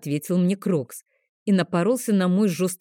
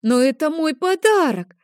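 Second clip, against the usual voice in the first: put-on much higher and louder childish wail; little boy near tears over his confiscated present, high and loud; tearful protest; 10 semitones higher, 5 dB louder than usual. A narrator's reading. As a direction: plead on the edge of tears.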